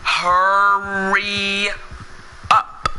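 very slow, drawn-out words. Shown as a demonstration. Gamer ordering a lagging companion along, very slow. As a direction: stretch each word right out, mockingly slow. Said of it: "do I have to say it slow?".

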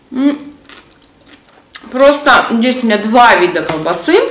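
speaking between bites; talking while chewing. Real, not acted. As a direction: mouth partly full of food. Seen 0: Spoonful taken at -1.0; jaw chewing and cheeks full early on.

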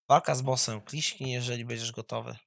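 ordinary talk, nothing marked

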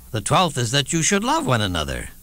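ordinary talk, nothing marked

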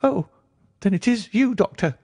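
high reedy voice, heaving with relief; great relief. A narrator's reading. Nervous patient recognising his doctor in the dark, relieved. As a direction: let the fear go in a high-voiced sigh. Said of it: "with a great heave of relief".

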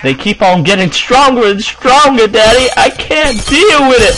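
put-on high, whiny southern kid; southern accent. Kid whining to Daddy about stronger foes, southern accent. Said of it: "with that southern accent".

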